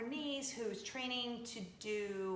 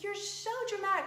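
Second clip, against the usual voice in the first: much higher eye-rolling daughter; a higher voice mocking a dramatic mom; teenage daughter; 10 semitones higher, 4 dB louder than usual. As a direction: play the unimpressed teen. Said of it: "She's like".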